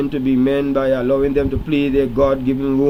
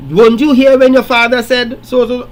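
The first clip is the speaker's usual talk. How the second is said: mock nagging mother; 6 semitones higher, 5 dB louder than usual. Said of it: "Don't come and ask".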